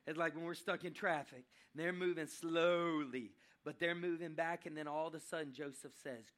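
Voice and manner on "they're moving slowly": louder, with SLOWLY stretched right out; drawn-out slowly. Shown as a demonstration. Preacher comparing the brothers' journey to stuck traffic, slow and drawn-out. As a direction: drag out the word slowly.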